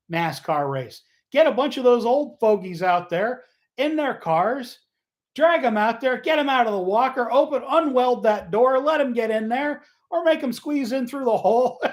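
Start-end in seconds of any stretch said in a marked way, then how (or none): 1.3-11.8 gleeful rant. Real, not acted. Host riffing to listeners about old drivers, gleefully worked up. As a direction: pitch it up and revel in the bit. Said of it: "I'm having too much fun with this".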